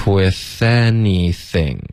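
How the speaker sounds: slow linked model, syllables held; slow practice. Shown as a demonstration. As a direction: draw out each sound for learners. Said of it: "第三段". (慢速连读示范)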